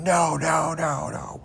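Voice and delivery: high, anguished "no" repeated, imitating Christopher Reeve; sorrowful cry. Superman grieving Lois in the first film, high anguished wail. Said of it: "You could just hear the, the echoes of his, his sorrow going".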